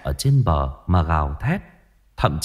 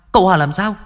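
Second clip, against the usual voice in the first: shrill higher repeat, rising in panic; higher and louder, fear breaking through; panicked question; 10 semitones higher, 4 dB louder, much faster than usual. A narrator's reading. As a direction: push the question up in sudden terror. Mother's alarm rising.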